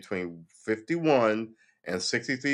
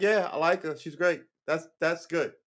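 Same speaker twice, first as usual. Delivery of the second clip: mock plain-spoken Tim Tebow; 7 semitones higher, 4 dB louder than usual. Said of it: "He'll probably be like".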